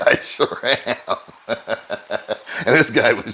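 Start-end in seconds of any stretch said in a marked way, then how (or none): 0.1-2.3 laughing. Real, not acted. Laughing with stair-climbing stranger.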